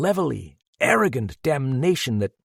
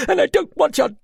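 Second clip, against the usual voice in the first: much higher, tearful, cried out against sobs; fighting sobs; 11 semitones higher, 5 dB louder than usual. A narrator's reading. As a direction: hold back the sobs while crying out. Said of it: "fighting those sobs".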